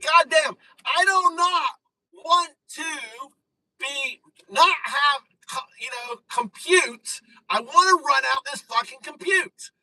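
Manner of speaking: hammed-up wail at the machine; mock frustrated yell. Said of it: "I'm like".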